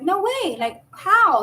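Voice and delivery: high, loud squeal of disbelief; can't believe the news; shocked teen asking how; stunned disbelief. Retold or imitated. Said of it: "And I'm like".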